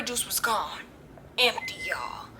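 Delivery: soft, voiceless whisper; hushed, unvoiced aside. Real, not acted. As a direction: breathe it out like a dramatic secret. Whispered reveal of empty juice.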